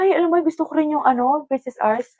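ordinary talk, nothing marked

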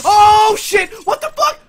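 sudden high frightened yell; scared horror game streamer. Real, not acted. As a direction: shout it at the shock.

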